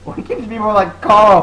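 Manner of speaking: loud, high, exasperated parental yell; mock scolding dad. Host plays dad comparing son to Carl, mock scolding shout. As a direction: berate the kid in a hammed-up shout.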